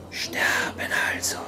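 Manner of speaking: a soft voiceless whisper, stating it flatly; eerie whisper. A narrator's reading. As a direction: whisper it like an unseen spirit. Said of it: "stellte die Stimme fest".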